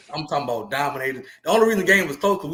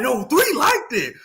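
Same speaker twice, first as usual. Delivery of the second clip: retold shout; 10 semitones higher, slower than usual. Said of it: "I'm like".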